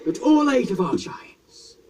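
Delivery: high, slow, loud declaration; dramatic. Acted. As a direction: proclaim it grandly. Dramatic speech.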